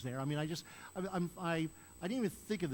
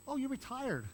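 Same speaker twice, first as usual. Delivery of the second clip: much higher, chirpy mock exclaim; mock surprise; 9 semitones higher than usual. People greeting the retired pastor, chirpy mock surprise. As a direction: play the cheerful neighbour who assumes.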